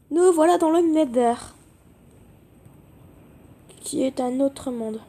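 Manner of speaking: usual speaking voice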